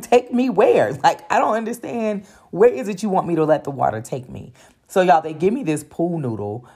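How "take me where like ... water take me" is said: high, laughing, bewildered questions; mock confusion with a laugh; laughing disbelief. To the crew telling her to relax, laughing high disbelief.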